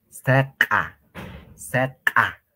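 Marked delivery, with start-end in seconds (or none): none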